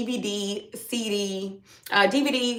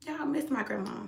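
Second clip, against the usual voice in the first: missing grandma; 5 semitones higher, 11 dB quieter than usual. Real, not acted. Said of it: "that was unexpected to feel like that".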